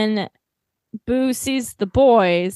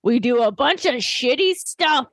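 put-on higher-pitched kid laying out the plan; excited, high mock explanation; Trunks; 6 semitones higher than usual. Voicing Trunks explaining show formula.

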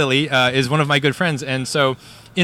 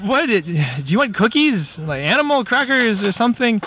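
higher-pitched, stammering bafflement; confused; 6 semitones higher, slower than usual. Acted. Dad guesses at the sphinx's riddle, flustered and confused. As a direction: grope for an answer, acting baffled. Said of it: "who is confused".

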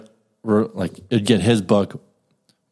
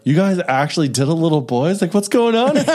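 mock shocked voice; 8 semitones higher than usual. Retold South Park priest scene.

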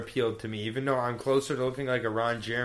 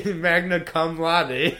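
laughing; 4 semitones higher, much slower than usual. Real, not acted. Jokes while laughing.

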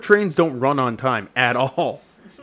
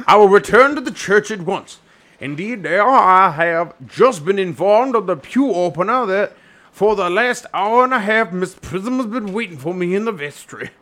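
raised, sing-song clergyman voice in a fake accent; hammed-up Doctor Chasuble; 4 semitones higher than usual. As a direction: proclaim it as a comic reverend.

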